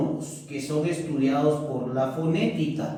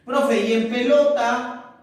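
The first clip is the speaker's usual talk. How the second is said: a keen pupil piping up, a bit higher and louder; eager student voice; 6 semitones higher, 4 dB louder than usual. Acted. Pupil calling out a question to the teacher, eager and higher.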